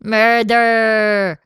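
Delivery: rolled r, bad Scottish murder; Scottish accent. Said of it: "in a bad Scottish accent".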